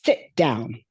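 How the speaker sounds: mild mock shout; retold stern yell. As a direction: bark the order like a furious counselor. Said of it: "that woman screamed at me".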